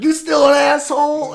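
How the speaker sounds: high, loud jeering call; mock rowdy shout. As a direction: holler it like ribbing an old pal. Acting out rowdy greeting in film.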